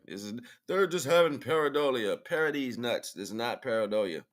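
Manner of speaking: normal talking voice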